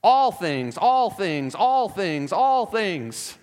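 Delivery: ALL punched loud, falling through each phrase; emphatic repetition. Real, not acted. Preacher counting out the verse's repeated phrase, emphatic. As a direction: hammer ALL every time, evenly repeated.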